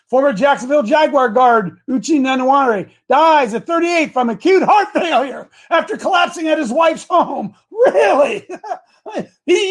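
high, loud, mock-astonished questions; hammed-up outrage with a laugh; sarcastic disbelief. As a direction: ridicule the official story. Mocking the official death story.

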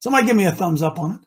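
ordinary talk

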